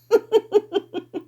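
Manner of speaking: put-on high drunken giggle; a high tipsy giggle. A narrator's reading. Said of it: "I giggled".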